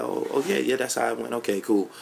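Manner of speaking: quick-to-agree, understated appeasing voice; placating agreement. Acted. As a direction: act out humouring someone to avoid an argument. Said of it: "So you kinda like".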